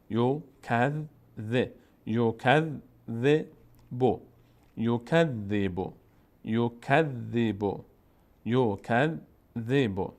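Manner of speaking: یو کذ drawn out very slowly, doubled ذ held; teacher drilling یو کذ syllable by syllable. Shown as a demonstration. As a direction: sound out یو کذ slowly, holding the doubled ذ. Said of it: "زال تشدید داره. یک بار به همراه ما قبلش".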